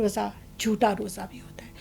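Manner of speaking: hushed aside about the false fast; whisper. Shown as a demonstration. Contrasting true and false fasting.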